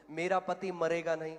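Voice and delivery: loud, firm declaration of faith. Retold or imitated. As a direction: voice a coach modelling the words to repeat. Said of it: "बोल इसको".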